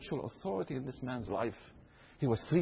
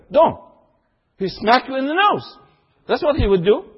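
fervent preaching; 12 semitones higher, 13 dB louder than usual. Acted. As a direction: preach it out with full force. Preaching at full force.

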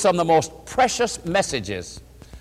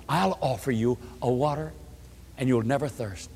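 gentle; 4 semitones lower, 6 dB quieter than usual. Retold or imitated. Jesus gently speaks to woman.

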